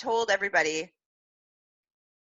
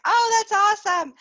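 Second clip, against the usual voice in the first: gushing reaction; 8 dB louder than usual. Retold or imitated. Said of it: "everybody's like".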